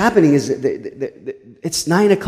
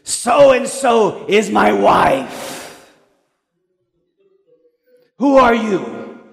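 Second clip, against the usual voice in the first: slow, higher mock drunk challenge; drunk husband; 5 semitones higher, much slower than usual. Drunk man demanding who the pastor is, slow shouted challenge. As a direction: drag it out like a suspicious drunk.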